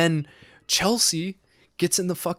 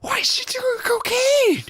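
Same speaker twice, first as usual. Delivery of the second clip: mock outrage; 7 semitones higher than usual. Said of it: "I was like".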